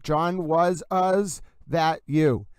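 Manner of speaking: "was" echoed as "was, us", very slow; echoed ending. Shown as a demonstration. Host imitating the voicemail echo, slow "was us". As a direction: repeat the tail of WAS as "us". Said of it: "A S echoes a little bit".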